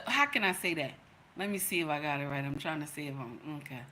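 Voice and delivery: low, very soft, quick aside to oneself; real under-the-breath self-talk; thinking aloud. Real, not acted. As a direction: mumble it while working out the wording. Talking to herself, working out wording.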